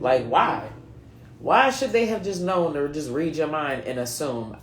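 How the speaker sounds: ordinary talk, nothing marked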